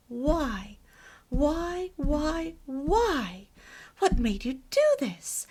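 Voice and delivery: loud, insistent mimicked questioning; incredulous demanding. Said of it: "she kept saying".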